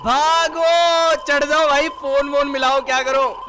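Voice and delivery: loud, high scream of fright; panicked shout. Real, not acted. Gamer screaming as the stranger charges the room, loud panicked shout. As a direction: scream and urge the character to run.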